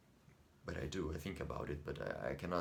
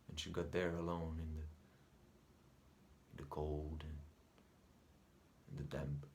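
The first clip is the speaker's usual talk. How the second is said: haunted imagining; 8 dB quieter than usual. Acted. Son pictures mother alone in the cold, haunted hush. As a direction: let each image sink into a hush.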